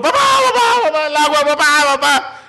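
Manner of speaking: high-pitched frantic yelling for Dad; panicked child screaming. Acted. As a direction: shriek like a scared kid in a flood. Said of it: "empecé a gritar". (niño gritando aterrado)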